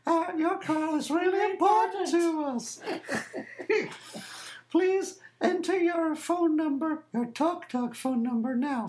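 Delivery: sing-song answerphone robot, mocked; automated phone voice. Caller mocking the Talk Talk answerphone, high prim voice. Mimicking Talk Talk's recorded message.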